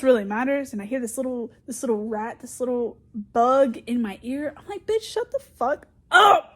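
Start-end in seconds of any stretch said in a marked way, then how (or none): 4.9-6.5 high, loud, drawn-out retort; exasperated shout. Retold or imitated. Acting out a retort to a nagging coworker, exasperated shout. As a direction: let the fed-up outburst rip, slowly.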